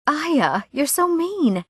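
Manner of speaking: high, swooping mock reproach; high-pitched teasing protest; playful chiding. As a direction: chide a teasing friend, pitched well up. Acted chiding of a teasing friend.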